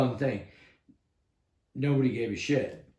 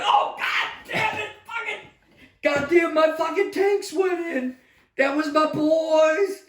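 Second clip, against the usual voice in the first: high, loud, cursing shout; furious outburst; 6 dB louder, slower than usual. Retold or imitated. Soldier reliving his tanks rolling out without him, furious high shout. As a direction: explode over the lost tanks.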